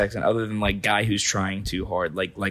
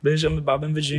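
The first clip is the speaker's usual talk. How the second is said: higher-pitched imitation Indian accent; mock Indian accent; 5 semitones higher than usual. Echoing the co-host's joke, mock Indian accent. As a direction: echo it in a put-on Indian accent. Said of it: "the Indians are just being silly".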